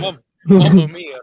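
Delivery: loud, slow Italian cry; jolly italian pizza chef. Pretend. Italian exclamation.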